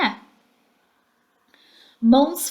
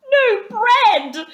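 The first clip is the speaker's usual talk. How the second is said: high, louder mournful groan; doleful groan; 13 semitones higher, 9 dB louder than usual. A narrator's reading. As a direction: groan it out in gloom. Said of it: "groaned the Mole dolorously".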